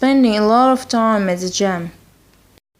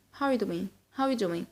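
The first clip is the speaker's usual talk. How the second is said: quick, reduced words, swallowing "are"; soft, casual fast-speech model; very fast demo; 2 semitones lower, 14 dB quieter, much faster than usual. Shown as a demonstration. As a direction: run "How are you doing" together with "are" almost gone. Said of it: "to say it very fast".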